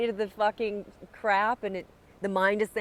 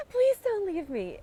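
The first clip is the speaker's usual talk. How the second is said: higher pleading voice; desperate pleading. Retold or imitated. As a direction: beg him not to go. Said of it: "a pleading, a desperation".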